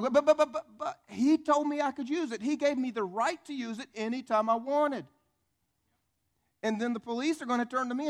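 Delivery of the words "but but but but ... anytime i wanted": high, loud stammering protest; panicked protest. Acted. Accused friend pleading with police over borrowed car, hammed-up panicked protest. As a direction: blurt a frantic, hammed-up defence.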